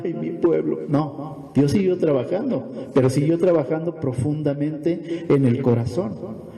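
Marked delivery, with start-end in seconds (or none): none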